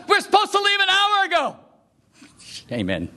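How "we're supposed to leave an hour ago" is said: high, urgent imitation of an impatient friend. Friend scolding him for oversleeping, lightly voiced urgency. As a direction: hurry him up, mock exasperation. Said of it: "Michael goes".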